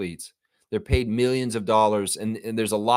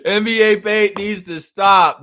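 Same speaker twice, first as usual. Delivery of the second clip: higher, louder and slower, like a rallying cry; mock proclamation; 9 semitones higher, 8 dB louder than usual. Real, not acted. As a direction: declare it to the crowd. Repeating slogan, then shouting it.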